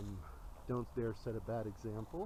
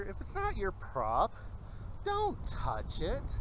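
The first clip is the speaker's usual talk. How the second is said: high, slow, drawn-out warning; stern theater saying; 8 semitones higher, 6 dB louder, slower than usual. Retold or imitated. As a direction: spell out the rule slowly and sternly. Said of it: "The old saying in theater".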